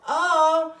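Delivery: hammed-up aha for kids; mock discovery. Shown as a demonstration. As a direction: act surprised to spot the E. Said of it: "the first thing I wanna tell myself is".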